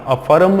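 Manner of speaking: अफर्म as "a-fa-ram", र fully pronounced; sounded R. Shown as a demonstration. Teacher warns learners how not to read it, sounded R. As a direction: voice the र in अफर्म as its own syllable. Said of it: "र को नहीं पढ़ना".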